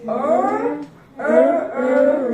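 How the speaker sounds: R trilled with the tongue rolling; rolled R. Shown as a demonstration. Teacher modelling a rolled R to a pupil. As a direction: roll the tongue on R. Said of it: "roll your tongue".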